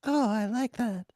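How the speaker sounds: put-on high falsetto girl. Co-host in bawdy romance role-play, girl voice. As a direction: coo it in a put-on girly pitch. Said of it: "find your girl voice".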